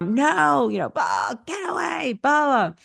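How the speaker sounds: a hint of a kid brushing you off; upset kid. Upset child refusing the parent's comfort, a touch of mock whine.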